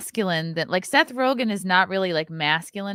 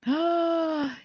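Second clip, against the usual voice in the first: sharp excited gasp; delighted gasp; 10 semitones higher than usual. Real, not acted. Thrilled by casting photo.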